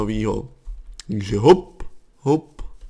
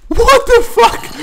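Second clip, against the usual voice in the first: very high, slow stunned cry; stunned what the fuck; 8 dB louder, much slower than usual. Real, not acted. Gamer stunned by the mob, high drawn-out disbelief. Shocked again.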